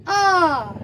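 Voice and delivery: अ held long and nasalized; अ as a long nasal "aan"; nasalized vowel. Shown as a demonstration. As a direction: open the mouth wide and send अ through the nose too. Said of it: "मुंह और नाक दोनों".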